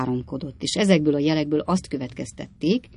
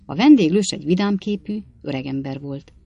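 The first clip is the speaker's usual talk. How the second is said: plain reading voice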